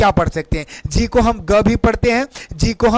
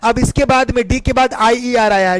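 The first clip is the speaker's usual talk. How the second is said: ordinary delivery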